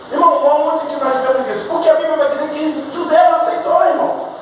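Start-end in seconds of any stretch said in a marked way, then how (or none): none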